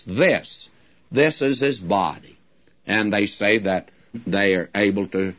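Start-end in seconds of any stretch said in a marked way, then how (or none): 0.0-2.4 "This, this" pressed hard, the rest falling; stress on this. Shown as a demonstration. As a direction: repeat "This", then hit it again. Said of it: "puts the emphasis upon hoc".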